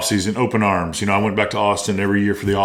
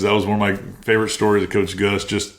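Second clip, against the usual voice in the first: ordinary talk, nothing marked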